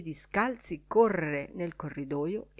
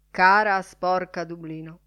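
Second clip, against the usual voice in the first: ordinary delivery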